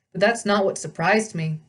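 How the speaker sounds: plain reading voice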